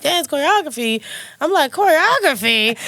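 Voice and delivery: high, slowed, disbelieving echo with a laugh; disbelief. Retold or imitated. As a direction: repeat the word as if it were absurd. Shocked at being asked to dance.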